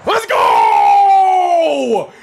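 loud, high celebratory yell; real hype shout; victory shout. Real, not acted. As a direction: scream the win. Celebrating a goal.

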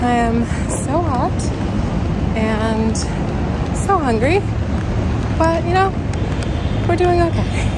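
breathless and high after running; panting between words; out of breath. Real, not acted. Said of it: "I'm so out of breath".

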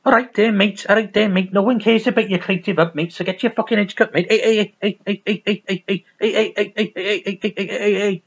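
rapid-fire cartoon Scouse; mock Merseyside accent. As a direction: put on a hammed-up Merseyside voice. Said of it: "I'm supposed to do Merseyside".

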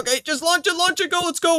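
high, eager go-go call; excited urging. Real, not acted. Player to friend launching the boat, excited urging. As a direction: urge the launch, pitched right up.

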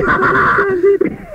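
shrill burst of laughter; high laugh. Real, not acted. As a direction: let out a high cackle. Laughs at own joke.

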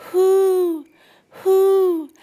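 high loud hooting owl call. A narrator's reading. Owl calling to Brooke, loud high hoots. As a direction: hoot like an owl.

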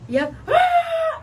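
sudden very high shrieking cry, slow; a laughing, shrieking ghostly ah; possessed shriek. Retold or imitated. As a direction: let out the spirit's wild high scream mid-story. Possessed girl reenacted at tomb.

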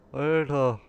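loud startled exclamation; shocked shout. Real, not acted. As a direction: blurt it out in shock. Shocked outburst in horror game.

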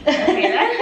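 amused friend. Retold or imitated. Retelling friend's reaction.

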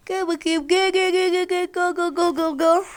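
high, loud cheering; loud egging on. Real, not acted. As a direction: hype yourself up calling out. Loudly egging on in game. (yüksek sesle gaz verme)

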